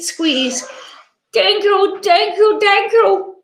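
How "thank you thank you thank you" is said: gushing thanks. Acted. Monster hugs them gratefully.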